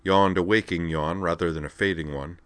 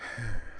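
soft exasperated sigh; 4 semitones higher, 7 dB quieter than usual. A narrator's reading. Woman giving up on hinting to him, sighing softly. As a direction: let out a quiet breath of resignation. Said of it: "Wakaba sighed".

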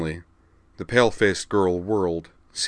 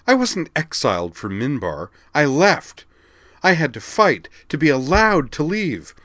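higher, louder indignant protest; indignant retort; 9 semitones higher, 7 dB louder than usual. A narrator's reading. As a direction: fight back hard. Mia retorts to remembered counselor.

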